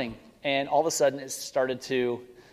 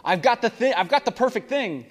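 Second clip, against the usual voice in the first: put-on eager kid; 9 semitones higher, 5 dB louder than usual. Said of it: "He's like".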